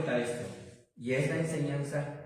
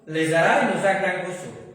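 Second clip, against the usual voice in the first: loud, raised preacher's voice building the point; preaching at full force; 5 semitones higher, 10 dB louder than usual. Acted. Pastor to congregation, preaching at full force. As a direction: pastor preaching loudly to his congregation about joy.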